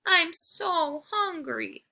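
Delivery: pitiful slow moan. Acted. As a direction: drag it out like a starving pup. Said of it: "I moan".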